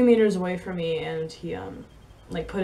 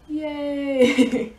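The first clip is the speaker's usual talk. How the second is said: high, loud sarcastic yay; mock cheer; 8 semitones higher, 6 dB louder than usual. Ending the tale of her fall, a loud mock cheer. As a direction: cheer it brightly, tongue in cheek.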